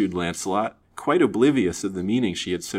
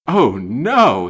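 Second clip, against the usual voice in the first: loud, alarmed exclamation; shocked stammer; 4 semitones higher, 10 dB louder than usual. A narrator's reading. Young man aghast at an avowal of love, shocked stammer. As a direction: burst out in dismay. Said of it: "he stammered".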